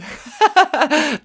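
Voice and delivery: loud, high burst of laughter; quick, loud cackling laugh. Real, not acted. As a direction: break up at your own joke. Breaks up at own joke.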